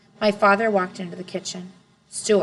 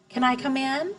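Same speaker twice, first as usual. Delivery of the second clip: put-on a four-year-old hugging his bear, higher pitch; little boy voice; 6 semitones higher than usual. A narrator's reading. Little brother to his sister, small high child voice.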